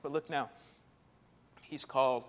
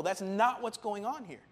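ordinary delivery

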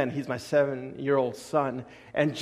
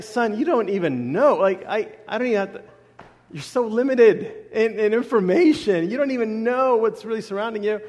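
higher, amused, half-laughing reply; amused dad; 7 semitones higher, 6 dB louder than usual. Retold or imitated. Dad answering his seven-year-old son, higher and amused. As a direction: laugh it off at the boy's logic.

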